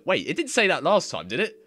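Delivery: high, loud disbelief; surprised. Real, not acted. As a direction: caught off guard. Surprised translation changed.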